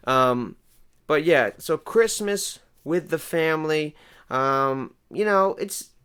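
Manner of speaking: usual speaking voice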